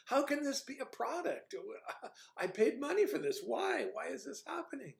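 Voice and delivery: high, pleading mock wail of disbelief; bewildered despair. Reviewer voices his real dismay at the show, high pleading whine. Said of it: "Or you can just go".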